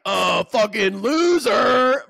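slow, loud jeering yell of an imagined critic; mocking shout. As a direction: bellow the insult out slowly. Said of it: "they're gonna be like".

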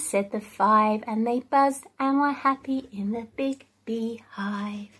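ordinary talk, nothing marked

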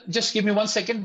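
ordinary delivery

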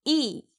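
teacher modelling a stressed 이; strong long vowel. Shown as a demonstration. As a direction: give 이 a firm, held "ee". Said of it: "좀 더 강하고 길게".

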